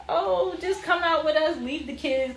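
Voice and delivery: high-pitched mock pleading; coaxing. Cousins urging a reluctant cousin to come out, coaxing.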